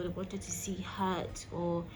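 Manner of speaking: usual speaking voice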